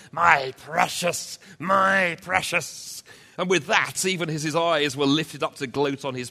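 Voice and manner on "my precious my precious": loud, slow, higher gloating hiss; Gollum gloating. Acted. Gollum gloating on his prize before he falls. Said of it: "Gollum cried".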